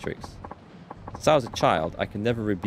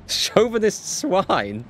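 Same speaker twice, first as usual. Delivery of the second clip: slow, laughing through the line; can't hold back laughter on the words; laughing while reading; 10 semitones higher, 3 dB louder, much slower than usual. Real, not acted.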